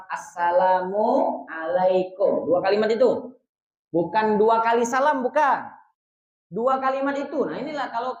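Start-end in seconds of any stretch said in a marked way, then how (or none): 0.1-2.5 Assalamualaikum said slowly in one unbroken breath; slow connected demo. Shown as a demonstration. Teacher shows joined greeting, slow and unbroken. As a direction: join Assalamualaikum smoothly, no gap. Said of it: "Harus menyambung dua kalimat salam".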